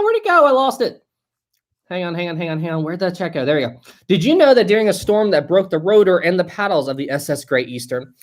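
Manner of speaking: usual speaking voice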